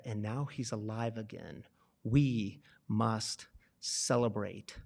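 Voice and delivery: low, slow, soft fatherly voice; tender father. Acted. Father reassuring his older son, tender and slow. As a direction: answer gently like a loving dad.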